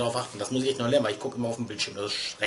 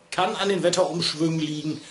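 ordinary talk

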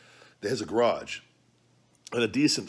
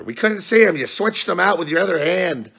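high, loud whiny mock voice; mocking viewer voice; 11 semitones higher, 10 dB louder than usual. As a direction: mimic the doubters in a shrill whine. Voicing doubtful viewers.

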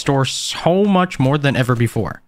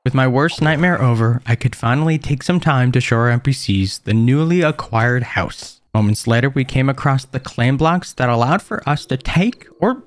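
louder, steady recap narration; voiceover narration; 6 dB louder than usual. A narrator's reading. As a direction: narrate the day like a video recap.

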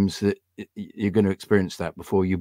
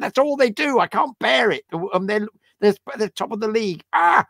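imitating his own furious rant, much higher-pitched; angry rant; 13 semitones higher than usual. Fan retelling to his daughter how he rages at the match, angry high rant. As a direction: rant like a frustrated fan losing it at the telly. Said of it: "You're so angry all the time".

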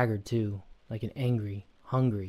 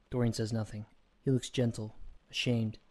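usual speaking voice

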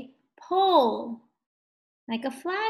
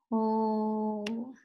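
HOLE with a long, held O; drawn-out slow; 4 dB quieter than usual. Shown as a demonstration. Teacher sounding out the word for tracing, drawn-out slow HOLE. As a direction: stretch HOLE out evenly on one note. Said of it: "the vowel does not say its name".

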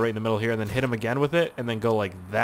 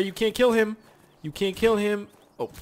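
usual speaking voice

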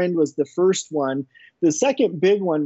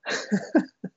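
soft breathy laugh; 5 semitones higher, 5 dB quieter than usual. Real, not acted. Laugh after punchline.

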